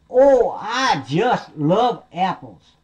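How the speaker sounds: high, slow, swooping delight; delighted little piggy. Acted. Piggy answering the wolf's apple offer, drawn-out delight. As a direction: draw it out with gushing relish.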